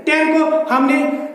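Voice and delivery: ordinary talk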